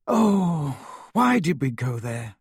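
groaning. A narrator's reading. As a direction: groan it out in dismay. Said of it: "groaned Hermione".

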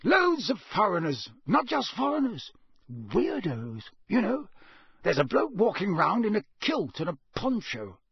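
put-on a wary old Scots countryman; rustic campsite owner. Campsite owner voiced by narrator.

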